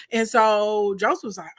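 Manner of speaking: normal talking voice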